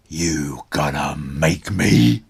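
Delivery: low, slow, loud dinosaur growl; roaring T-Rex. A narrator's reading. T-Rex taunts Connor, low slow roar. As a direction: taunt him like a huge beast. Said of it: "T-Rex roared".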